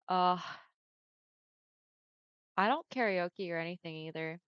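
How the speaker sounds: usual speaking voice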